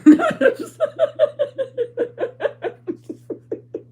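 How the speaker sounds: long, high, loud peal of laughing; helpless laughter. Real, not acted. Vlogger amused by her son's reaction, helpless laughter. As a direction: laugh uncontrollably, slowly petering out. Cracks up again over her story.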